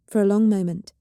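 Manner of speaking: usual speaking voice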